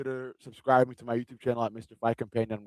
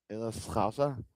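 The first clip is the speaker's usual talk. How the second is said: a quieter, muted attempt at a Scouse accent; softer Scouse accent try; 2 semitones lower, 10 dB quieter than usual. To his chat, retrying the Scouse accent quietly. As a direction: mumble a Liverpool Scouse Scouser. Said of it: "I can't do it".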